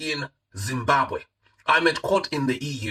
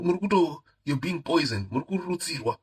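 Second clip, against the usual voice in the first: ordinary talk, nothing marked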